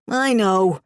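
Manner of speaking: resigned groan in a put-on caller's voice. A narrator's reading. Caught caller grudgingly admits it, groaning. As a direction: groan the admission reluctantly. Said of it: "he groaned".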